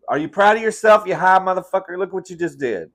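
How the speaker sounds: high, loud telling-off; real scolding. Real, not acted. Scolding the pet that knocked gate down.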